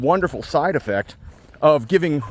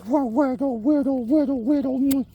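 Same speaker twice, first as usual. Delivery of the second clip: much higher cooing nonsense; 12 semitones higher, slower than usual. Real, not acted. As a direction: coo at the dog in a high voice. Baby talk to the dog.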